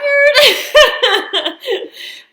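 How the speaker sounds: high, loud laugh through real tears; embarrassed teary laugh. Real, not acted. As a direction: laugh off the crying.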